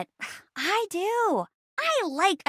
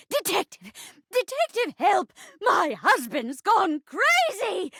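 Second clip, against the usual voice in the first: panicked cry for help; 3 semitones higher than usual. Acted. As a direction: shout for the detective in a hammed-up panic. Acted cry to detective for help.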